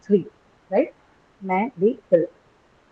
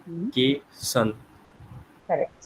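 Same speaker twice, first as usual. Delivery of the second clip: ordinary talk, nothing marked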